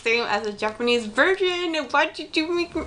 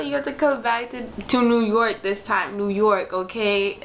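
usual speaking voice

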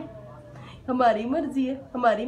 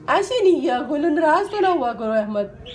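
louder, higher mock scold; playful scolding; 4 semitones higher, 6 dB louder than usual. To sulking Ahmad, louder playful scold. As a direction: push it up like a teasing telling-off.

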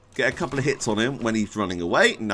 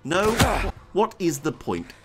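ordinary talk

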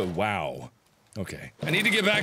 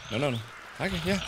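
ordinary delivery

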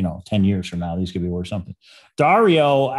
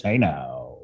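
ordinary delivery

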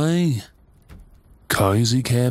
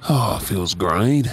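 normal talking voice

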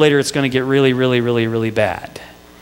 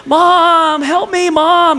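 mock yell for mom; 12 semitones higher, much slower than usual. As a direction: call to mom like a trapped little boy. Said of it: "but I'd yell".

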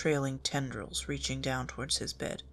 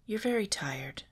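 sympathetic whisper; 7 semitones higher than usual. A narrator's reading. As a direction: gently comfort him in a whisper. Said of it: "Daphne said sympathetically".

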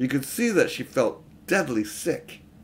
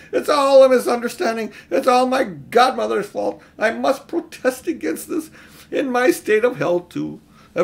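much higher, loud whining lament; wailing; 10 semitones higher, 7 dB louder than usual. A narrator's reading. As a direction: wail it like a frightened old man. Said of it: "it was wailing on".

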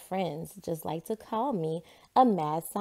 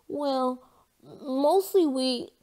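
put-on higher, slow, halting boy's voice; Jasper about to sneeze; 7 semitones higher, 5 dB louder, much slower than usual. A narrator's reading. Jasper explaining to Margaret, halting before a sneeze. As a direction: build up to a sneeze. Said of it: "Jasper before sneezing".

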